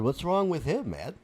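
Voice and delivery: ordinary talk, nothing marked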